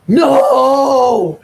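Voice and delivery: loud high shout, hammed up; angry shout. Girlfriend shouting back at her partner's repeated question, angry. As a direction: yell it like she's fed up. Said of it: "she was just like".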